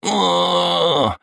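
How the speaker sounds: howl of pain. A narrator's reading. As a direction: wail as your arm is twisted. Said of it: "завопил модник". (вопль боли)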